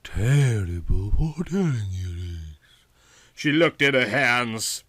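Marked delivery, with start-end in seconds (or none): 0.0-2.6 Yvonne's voice. A narrator's reading. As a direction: play her sultry and unhurried.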